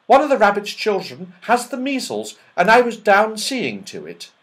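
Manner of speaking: high, loud elf voice; chirpy little elf apologising. Acted. Elf apologising to the children, high loud elf voice.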